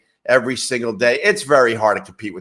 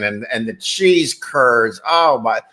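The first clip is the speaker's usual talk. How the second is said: ordinary talk, nothing marked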